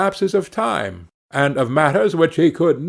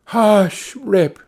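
put-on high-pitched mother hushing her child; crying out; 6 semitones higher than usual. A narrator's reading. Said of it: "cried she".

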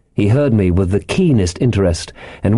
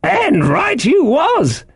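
high, drawn-out castaway exclamation; excited cry; much slower than usual. A narrator's reading. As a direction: cry it out slowly, pitched very high. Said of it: "he cried".

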